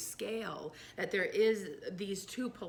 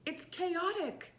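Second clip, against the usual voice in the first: animated, high-pitched; 8 semitones higher than usual. Real, not acted. Describing baby learning to walk.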